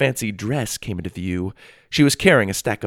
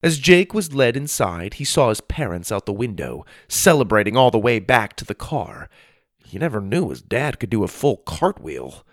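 ordinary delivery, nothing marked

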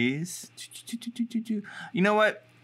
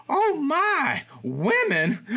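put-on much higher and louder, a scandalised little old lady; shocked old lady; 3 dB louder, much slower than usual. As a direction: gasp it out like a sweet old lady who is horrified. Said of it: "she looks at the picture and she goes".

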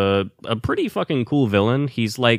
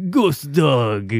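higher, put-on actor voice; Forest Whitaker; 5 semitones higher than usual. Co-host joining the running bit, put-on Forest Whitaker voice. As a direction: echo the title as the movie star. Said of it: "my impression of Forrest Whitaker".

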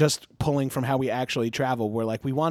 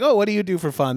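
higher, louder chirpy question; eager small talk; 6 semitones higher, 4 dB louder, faster than usual. Retold or imitated. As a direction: ask brightly like a keen guest. Acted quote at party.

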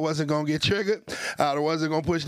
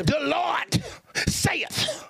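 put-on high, booming old-church preacher voice; fiery pulpit delivery; Baptist preacher; 7 semitones higher than usual. Shown as a demonstration. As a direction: proclaim scripture in measured bursts with pauses. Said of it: "it's the preacher voice".

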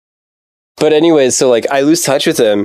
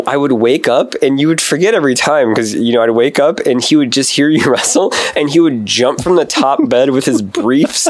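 ordinary talk

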